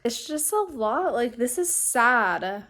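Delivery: emotional, close to tears, voice wavering; trying not to cry while talking; holding back tears. Real, not acted. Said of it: "I'm trying not to cry".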